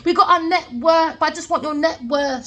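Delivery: high, smug mock voice of a greedy crew; a hammed-up boastful gang voice; mocking quote of the schemers. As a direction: mimic them showing off, then a laugh. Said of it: "They're all going around".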